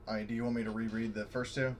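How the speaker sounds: ordinary talk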